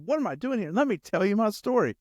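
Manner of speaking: high-pitched, affronted retort; indignant comeback. Acted. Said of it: "That's exactly what Elijah does".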